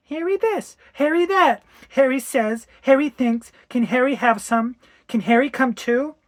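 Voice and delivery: high, loud mocking imitation of a child; exasperated mimicry. Wife imitating daughter's pestering, exasperated mock child.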